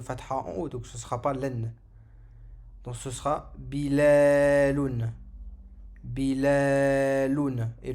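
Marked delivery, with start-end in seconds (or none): 3.6-5.1 BILALOUN with a loud, long held LA; prolonged middle vowel. Shown as a demonstration. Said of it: "avec le alif prolongé". 6.0-7.7 BILALOUN very slow, loud, LA held long; prolonged middle vowel. Shown as a demonstration. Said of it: "avec le alif prolongé".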